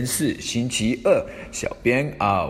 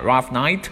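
"rough night" with night gliding up; rising question tune; 2 semitones higher than usual. Shown as a demonstration. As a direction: ask "rough night" with a rise at the end. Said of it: "读的时候要读成声调".

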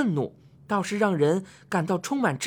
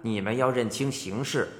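低沉缓慢的威严神君; 压低嗓子摆出帝王架子; 威严的天帝; 9 semitones lower than usual. Acted. 天帝训话审问. (低沉威严的天帝腔)

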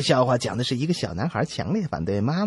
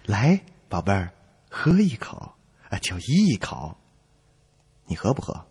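coaxing parent; 6 semitones lower, 4 dB quieter than usual. Acted. Coaxing then scolding a baby.

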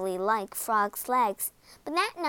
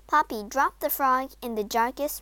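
ordinary delivery, nothing marked

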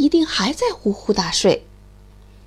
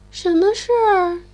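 sleepy; 7 semitones higher than usual. Acted. Sleepy hedgehog answers.

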